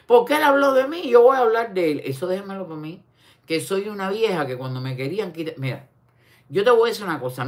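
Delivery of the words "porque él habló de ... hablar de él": mocking imitation. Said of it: "me sonó eso".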